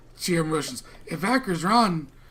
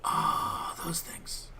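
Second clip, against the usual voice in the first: hushed, breathy whisper; 7 dB quieter, much slower than usual. Acted. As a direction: whisper it as a secret. Whispered reply in character.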